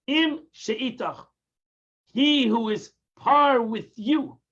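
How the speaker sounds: Im she'itach said slowly, each part set apart; slow, split apart. Shown as a demonstration. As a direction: draw the two words out slowly, one by one. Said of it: "is divided, the Gemara says, in two words".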